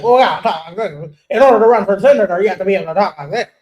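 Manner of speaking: put-on, mumbled proper-speaker voice; mock formal candidate. Host mocking critics who want senators to sound proper, mock formal. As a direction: lightly mimic the expected senator talk. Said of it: "because she's not talking like this".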